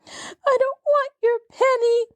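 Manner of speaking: put-on very high, tearful child's voice; a small child refusing through sobs; sobbing little girl. A narrator's reading. Lottie sobs back at Jessie, high child's sob. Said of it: "sobbed Lottie".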